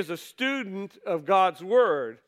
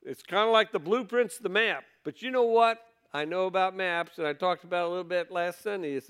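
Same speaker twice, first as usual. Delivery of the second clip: ordinary talk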